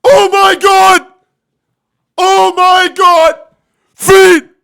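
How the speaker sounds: loud, high, drawn-out mock yell; high-pitched, over-the-top shouting; mock freak-out. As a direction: freak out loudly in exaggerated awe. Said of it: "Why isn't he going".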